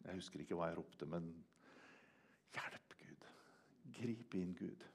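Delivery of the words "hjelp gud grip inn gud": breathy whispered cry for help; hushed desperate prayer; desperate whispered plea. Retold or imitated. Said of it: "Og så ropte jeg".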